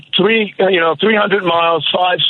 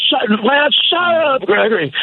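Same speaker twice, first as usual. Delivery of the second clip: sudden high yelled order to shut up; grandfather yelling; 9 semitones higher than usual. Retold or imitated. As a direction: bark it like a fed-up grandfather. Grandfather yells during Cuban crisis.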